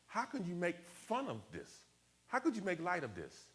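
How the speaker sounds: retold indignant incredulity; indignant disbelief. Retelling his hurt disbelief at jokes.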